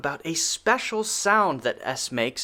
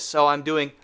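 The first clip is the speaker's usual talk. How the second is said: normal talking voice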